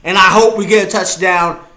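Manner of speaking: ordinary delivery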